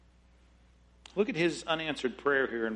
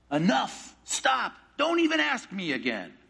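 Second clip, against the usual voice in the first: sharp refusal; 7 semitones higher than usual. Pretend.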